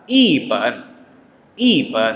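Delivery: long falling I, low pen; fourth tone on i. Shown as a demonstration. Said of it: "i-nya jadi nada keempat".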